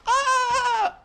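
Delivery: high, short mock shriek; mock scream. Dad's bedtime story scream, played for laughs. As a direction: yelp like skin coming off.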